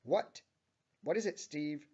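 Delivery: loud, high, irritated outburst; angry scream. A narrator's reading. Tammy answers her manager Steve, screaming angrily. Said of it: "screams out Tammy angrily with a scowl".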